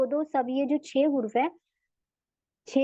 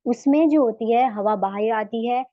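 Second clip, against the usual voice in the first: ordinary delivery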